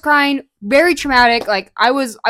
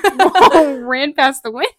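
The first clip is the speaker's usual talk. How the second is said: laughing; 7 semitones higher, 7 dB louder, slower than usual. Real, not acted. Laughing at something outside.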